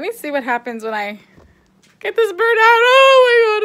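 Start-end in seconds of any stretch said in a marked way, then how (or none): 2.0-3.6 high-pitched panicked wail; freaking out. Real, not acted. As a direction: cry out in real alarm as the bird moves. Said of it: "I'm freaking out".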